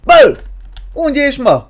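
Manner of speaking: high, loud startled shout; calling out. Real, not acted. Player to the missing baby, high alarmed call.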